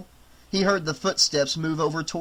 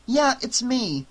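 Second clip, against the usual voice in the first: anxious; 8 semitones higher, slower than usual. Acted. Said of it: "sounding anxious".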